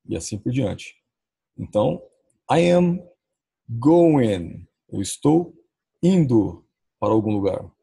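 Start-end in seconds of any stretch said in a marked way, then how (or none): 2.5-4.7 "I am going" said slowly, word by word, with pauses; pronúncia didática. Shown as a demonstration. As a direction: model "I am going" for learners, each word apart. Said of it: "É assim que se fala". (pronúncia pausada de "I am going", palavra por palavra)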